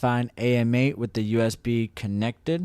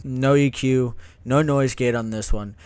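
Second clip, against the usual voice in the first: ordinary delivery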